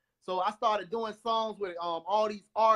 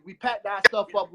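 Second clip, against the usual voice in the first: normal talking voice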